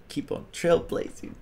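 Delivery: soft whispered echo. Real, not acted. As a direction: breathe it like an echo. Catchphrase echoed whispered.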